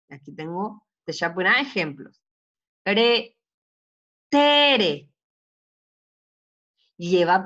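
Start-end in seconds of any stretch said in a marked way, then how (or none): 2.8-5.1 "Re, tere" split, TE high and loud; stress on middle syllable. Shown as a demonstration. Teacher gives an example, "Re, tere" with strong TE. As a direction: punch TE in "Re, tere", let the last RE drop. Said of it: "es aquí el fuerte".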